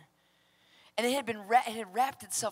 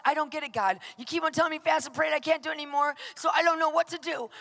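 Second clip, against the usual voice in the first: higher, rushed complaint to God; frustrated plea; 5 semitones higher, faster than usual. Retold or imitated. Burned-out preacher complaining to God, frustrated. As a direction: plead as if you've run out of options. Acted desperate prayer to God.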